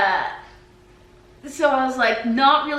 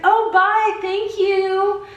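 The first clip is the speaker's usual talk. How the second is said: high, loud mock goodbye; imitated friendly farewell; 10 semitones higher, 6 dB louder, much slower than usual. Stranger answers her goodbye in the store, mock-friendly. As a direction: play the over-friendly stranger signing off.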